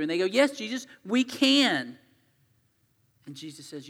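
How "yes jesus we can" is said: eager assurance. Acted. Disciples insist they can.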